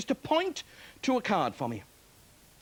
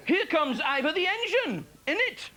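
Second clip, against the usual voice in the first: Ivor impression; 7 semitones higher, slower than usual. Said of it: "of Ivor the steam engine".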